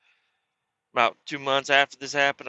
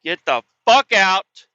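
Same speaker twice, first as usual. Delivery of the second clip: loud, slow furious yell; mom yelling; 8 semitones higher, 10 dB louder, slower than usual. Retold or imitated. Mother throwing her son out of the house, loud slow yell. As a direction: bellow it slow and hard. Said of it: "She said".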